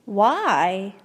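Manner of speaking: ordinary delivery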